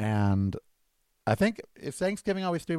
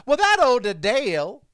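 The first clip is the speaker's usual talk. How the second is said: campy Southern gossip voice; Southern accent; 7 semitones higher, 4 dB louder than usual. Acted. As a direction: play it as a high-voiced Southerner. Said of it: "as if she were Leslie Jordan".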